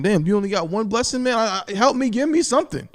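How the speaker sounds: soft, rising mock plea; begging Esau. Esau begging his father Isaac for a blessing, softly pleading. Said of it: "He's saying".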